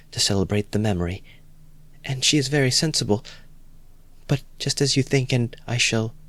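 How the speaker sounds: lightly voiced anxious girl; timid young Sonia. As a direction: plead gently as a shy young woman.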